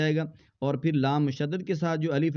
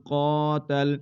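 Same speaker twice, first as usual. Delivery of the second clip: slow, drawn-out Quranic recitation with long pauses; measured tajweed reading; 2 semitones higher than usual. Shown as a demonstration. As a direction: recite each word slowly and apart. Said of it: "tajweed reading demo".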